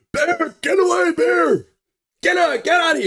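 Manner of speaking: high, loud shouting to scare off a bear; retold yell. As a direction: yell at the bear to drive it away. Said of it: "Alex is standing there yelling".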